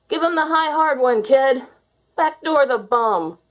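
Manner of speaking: high, loud cheering shout; crowd shouting. A narrator's reading. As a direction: yell it from the stands. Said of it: "It felt good to shout out those words".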